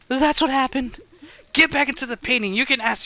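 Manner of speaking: high, loud, fast scolding; exasperated order. Acted. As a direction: shoo the ghost off. Ordering ghost back.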